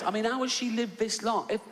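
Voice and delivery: high, incredulous indignant rant; fuming rant. Acted. Comic ranting at the audience about a stranger's allergy, incredulous. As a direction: push the pitch up in mock outrage. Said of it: "I was fuming".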